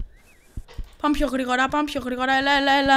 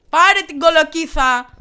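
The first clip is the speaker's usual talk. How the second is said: louder, slow, drawn-out yell; yelling at the goose; 4 semitones higher, 9 dB louder than usual. Real, not acted. Player yelling at the goose to grab the pumpkin, loud and slow. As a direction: call out the order, stretching it.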